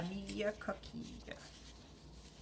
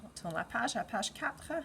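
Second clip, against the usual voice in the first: put-on reading to a toddler. Teacher reads the story to children.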